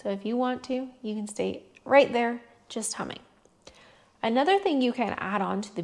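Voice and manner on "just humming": whispered. Real, not acted. Soft aside during humming practice.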